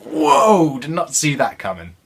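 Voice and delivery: mock surprise. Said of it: "I'd be like".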